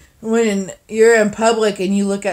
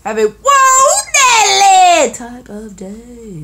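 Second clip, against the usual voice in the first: high, slow singsong goodbye; higher, slower cutesy farewell; sing-song sign-off; 11 semitones higher, 6 dB louder, slower than usual. Real, not acted. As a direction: stretch it out, sweet and sing-song. Playful sign-off.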